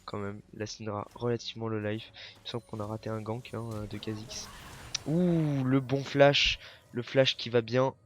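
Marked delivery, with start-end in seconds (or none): 5.1-8.0 louder, higher burst of hype; excited call. Real, not acted. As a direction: call the play as it happens. Caster reacts to a flash play. (cri enthousiaste de commentateur)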